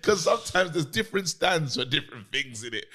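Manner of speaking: ordinary delivery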